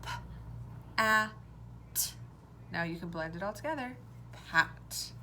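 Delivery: B, a, t separated, then blended into bat; sounding out. Shown as a demonstration. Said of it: "Say the sounds with me".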